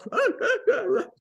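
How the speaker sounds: high, breaking laugh through tears; a laugh that cracks into crying; teary laugh. Real, not acted. Meeting her mentor at last, a teary breaking laugh. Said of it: "crying and everything".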